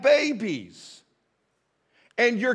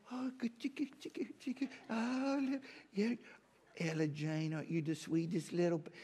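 put-on baby talk; 2 semitones lower, 15 dB quieter than usual. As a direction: coo at the baby like a doting grandpa. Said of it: "we just change the way we talk".

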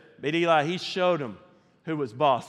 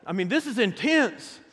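ordinary talk

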